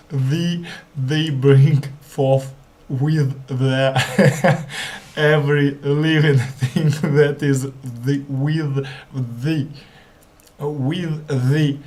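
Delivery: reading through giggles; laughing while reading. Real, not acted. Learner reading Genesis aloud, breaking into laughter. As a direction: try to read the verse but keep laughing. Reader cracks up mid-verse.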